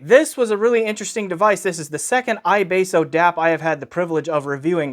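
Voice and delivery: normal talking voice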